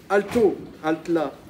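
a guard yells at a figure in the dark; shouted challenge. Acted. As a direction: bark the halt like a guard at night. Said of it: "avait crié une voix".

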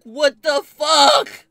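incredulous yell; outraged disbelief. Retold or imitated. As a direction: stretch it in stunned outrage. Outraged friends went back.

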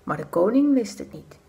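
ordinary delivery

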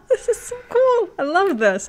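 high, bright burst of delight; squealing with real delight; excited squeal. Real, not acted. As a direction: gush like a thrilled fan. Thrilled by the game scene.

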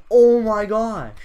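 excited. Real, not acted. Watching clip, getting excited.

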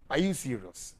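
ordinary delivery

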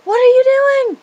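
high, loud shocked shout; shocked yell. Retold or imitated. Throwing water on the burning blanket, high shocked shout.

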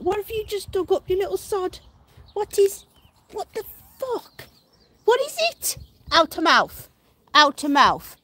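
high, loud telling-off to a pet; rising alarm, then firm loud commands; alarmed scolding. Real, not acted. Owner to pet that dug something up, alarmed scolding.

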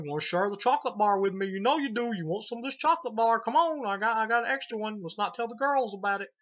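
high, loud, eager pestering voice; coaxing character. Retold or imitated. Fat kid pesters Vic to share chocolate, high loud coaxing. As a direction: wheedle in a hammed-up high voice.

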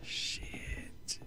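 soft frightened whisper; whispering while hiding. Real, not acted. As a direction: breathe it out, hidden. Hiding from pursuer.

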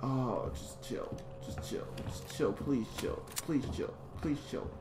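soft, repeated nervous begging; hushed pleading. Real, not acted. Player begging the game's threat to chill, hushed pleading. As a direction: plead under your breath, over and over.

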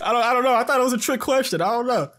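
loud, high flustered protest; startled protest. Real, not acted. Gamer after a wrong answer, loud high flustered protest. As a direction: caught out by the trick.

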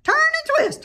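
high, loud honk; shrill honking order; goose honking. A narrator's reading. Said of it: "honked the goose".

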